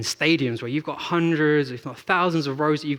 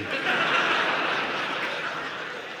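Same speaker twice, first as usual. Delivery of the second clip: a burst of real laughter with no voice behind it; breathy real laugh; 4 dB quieter than usual. Real, not acted. Mac doesn't know Beckham.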